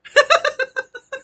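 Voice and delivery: loud high cackle of laughter. Real, not acted. Laughs after quoting client.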